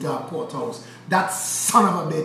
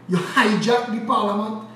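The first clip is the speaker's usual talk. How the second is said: ordinary talk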